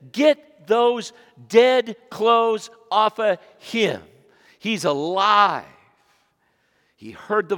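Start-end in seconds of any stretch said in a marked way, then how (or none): 0.1-5.7 slow, loud proclamation; slow booming order; loud slow command. Acted. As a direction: drag each word out, loud and commanding. Jesus commands grave clothes off.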